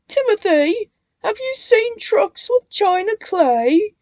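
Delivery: high, loud sing-song ask; chiming together. A narrator's reading. Twins asking Timothy about trucks, chiming high. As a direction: chime it out brightly. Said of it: "they chimed".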